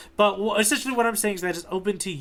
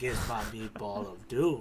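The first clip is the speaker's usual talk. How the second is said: mock villain boast; 6 semitones lower, 9 dB quieter than usual. Mock villain line for a character.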